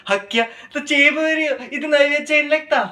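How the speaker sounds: high, held-up pitch in mock complaint; put-on female voice, pitched high; mock annoyed girlfriend. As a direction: imitate a woman put off by clinginess. Mimics woman rejecting a hug.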